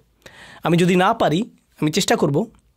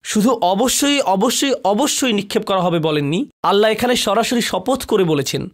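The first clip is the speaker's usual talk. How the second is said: usual speaking voice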